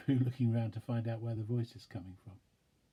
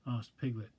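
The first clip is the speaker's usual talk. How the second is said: ordinary delivery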